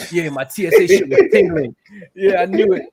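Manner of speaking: loud, high, imitated self-congratulation; mock pumped-up boasting, laughing; hyping himself up. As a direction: act out a man psyching himself up. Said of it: "he starts hyping himself up".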